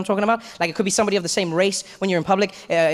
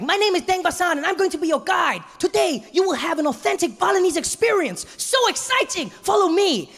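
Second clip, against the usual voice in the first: much higher, sing-song guide voice; cheery tour guide; 10 semitones higher than usual. Retold or imitated. Tour guide introducing himself to tourists, chirpy and excited. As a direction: lead the group with eager excitement. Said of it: "so he's like".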